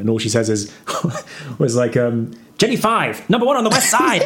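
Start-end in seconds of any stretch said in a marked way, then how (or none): 2.6-3.3 high, bright catchphrase voice; high-pitched character voice. Retold or imitated. Imitating the Japanese woman's catchphrase. 3.3-4.2 high, giggly catchphrase; half-laughing, squeaky delivery; high-pitched character voice. Retold or imitated. First take of the catchphrase.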